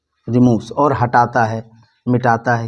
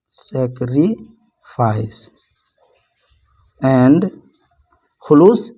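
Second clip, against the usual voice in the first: slow dictation; much slower than usual. Shown as a demonstration. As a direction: dictate "sacrifice" very slowly, sac-ri, pause, fice. Teacher dictating word while writing.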